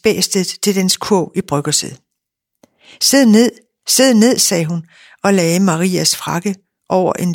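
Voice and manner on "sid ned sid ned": loud, high sit command; telling the excited labrador to sit; firm command to dog. Acted. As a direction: order the dog down sharply. Ordering the big dog down.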